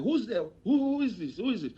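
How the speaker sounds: high, startled questions; surprised officer. Retold or imitated. Officer asking who it is.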